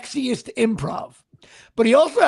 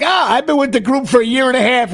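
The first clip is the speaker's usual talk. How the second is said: loud, boastful swagger; cocky young guy; 3 semitones higher, 11 dB louder than usual. Retold or imitated. Mocking his own younger self in the sketch group, loud and cocky. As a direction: puff yourself up like a know-it-all rookie. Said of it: "And I'm the cocky guy".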